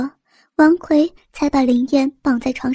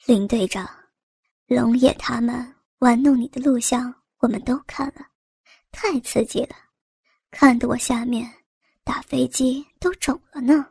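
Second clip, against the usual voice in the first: hushed, leering taunt; soft, insinuating tease; teasing; 2 semitones lower, 4 dB quieter than usual. A narrator's reading. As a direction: needle them quietly. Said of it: "继续调笑道".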